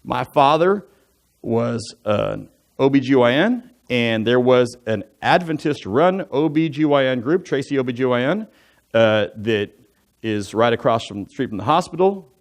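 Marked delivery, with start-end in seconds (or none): none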